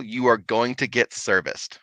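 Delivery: ordinary talk, nothing marked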